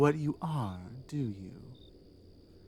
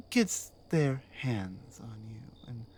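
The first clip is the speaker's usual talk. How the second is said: whisper; 3 semitones lower, 6 dB quieter than usual. Acted. Captor threatening captive.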